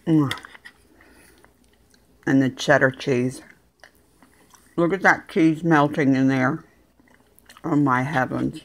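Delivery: ordinary talk, nothing marked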